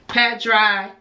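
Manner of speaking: ordinary delivery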